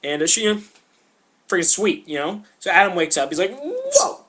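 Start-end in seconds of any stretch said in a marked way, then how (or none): 3.6-4.2 very high, loud whoa; swooping high cry of surprise; amazed. Acted. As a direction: yelp in mock astonishment. Adam wakes and sees woman.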